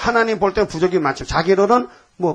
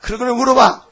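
louder, higher, fed-up snap; exasperated retort; 6 semitones higher, 4 dB louder than usual. Acted. Preacher answering a petty prayer, fed-up and loud. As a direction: brush off the silly question sharply.

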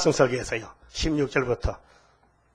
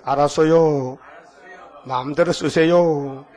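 slow, drawn-out model answer; measured call-and-response prompt; leading a response; 3 dB louder, slower than usual. Shown as a demonstration. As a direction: stretch each ending for the congregation to repeat. Models response for congregation to repeat.

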